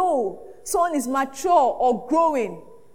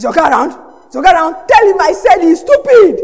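loud, hard demand at the front desk; angry visitor; 2 semitones higher, 12 dB louder than usual. Retold or imitated. Angry friend at the office demanding the boss, loud. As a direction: bark it like a furious friend. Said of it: "very angry with him".

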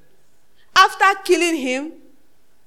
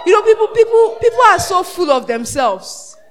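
shout; 4 semitones higher, 7 dB louder than usual. Real, not acted. Preacher calls out to congregation.

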